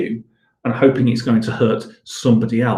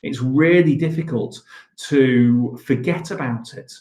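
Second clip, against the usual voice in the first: ordinary talk, nothing marked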